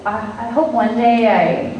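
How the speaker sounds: ordinary delivery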